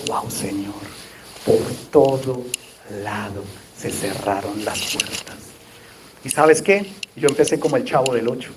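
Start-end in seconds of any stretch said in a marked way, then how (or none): 0.1-5.4 despairing whisper. Retold or imitated. Retold prayer of despair.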